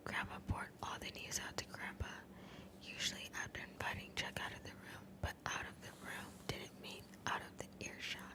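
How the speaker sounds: hushed, voiceless ASMR narration; whispered story reading. Acted. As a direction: read the story entirely in a whisper.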